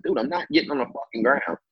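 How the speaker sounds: high-pitched retold refusal; half-hysterical objection; mock hysterical. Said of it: "kinda hysterical".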